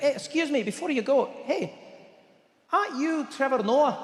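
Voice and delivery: high-pitched acted waiter. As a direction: play a waiter stopping a departing customer. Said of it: "the waiter waves us off".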